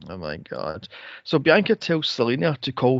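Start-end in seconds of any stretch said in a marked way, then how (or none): 0.1-0.9 very low and much softer; deflated oh my God. Real, not acted. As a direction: mutter it defeated. Exasperated groan.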